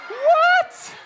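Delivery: very high, loud shriek; astonished shriek. Acted. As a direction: shriek in stage amazement. Astonished at the dog's trick.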